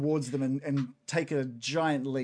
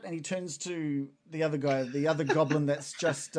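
normal talking voice

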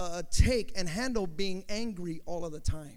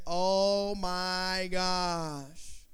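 slow dramatic; much slower than usual. Acted. Preacher dramatizes angry dad.

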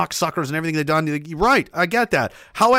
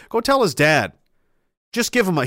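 ordinary talk

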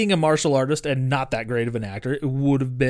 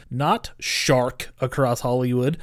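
ordinary delivery

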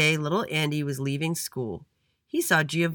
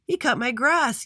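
high, louder yell; 10 semitones higher, 6 dB louder than usual. A narrator's reading. Jace vouching for Andy at the meeting, yelling. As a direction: yell it out in a raised pitch. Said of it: "yelled Jace".

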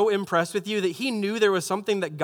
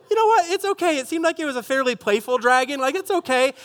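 much higher, mock-casual shrug at a scary dream; mock dreamer; 7 semitones higher than usual. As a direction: squeak it out like it's no big deal. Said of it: "and you're like".

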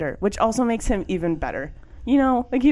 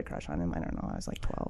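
embarrassed near-whisper; 14 semitones lower, 13 dB quieter than usual. Real, not acted. Embarrassed confession trailing off.

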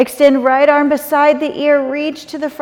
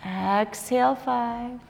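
softer, slow counting of breaths; 3 semitones lower, 4 dB quieter than usual. Acted. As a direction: count quietly, leave long gaps. Yoga teacher counts held breaths.

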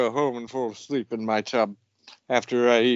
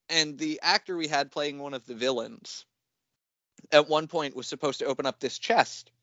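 ordinary talk

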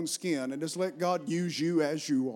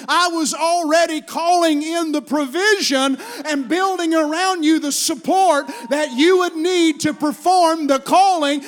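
loud, raised, emphatic sermon delivery; full-force preaching; 8 semitones higher, 11 dB louder, slower than usual. Acted. Preacher voices God's answer to the excuses, forceful preaching. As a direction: drive each phrase home at full volume.

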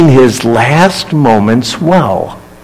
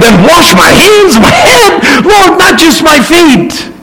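exclaiming; 13 semitones higher than usual. Acted. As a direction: cry out eagerly, all in. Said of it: "He exclaimed".

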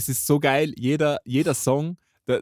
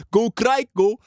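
put-on high loud Japanese-accented English; anime song accent; 9 semitones higher, 5 dB louder, slower than usual. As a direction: belt it like an anime opening. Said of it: "mit diesem geilen Akzent".

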